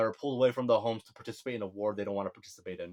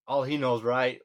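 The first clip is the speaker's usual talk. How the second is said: normal talking voice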